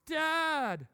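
put-on high, whining child calling Dad; whiny kid. Son whining to his dad for puzzle help. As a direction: plead like a helpless little boy.

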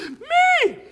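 high, loud eager cry; excited shout. Retold or imitated. Teen answering the altar call, high excited shout. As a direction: leap at the chance with a yell. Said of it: "I jump up out of my seat".

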